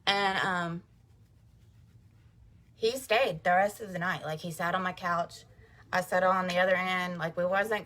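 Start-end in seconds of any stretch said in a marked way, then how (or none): none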